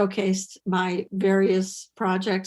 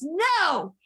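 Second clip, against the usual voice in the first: high, defiant mock protest; George Carlin; 6 semitones higher than usual. Comedian answers manager's scolding in the car, mock-indignant protest. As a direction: object indignantly, pitch jumping up.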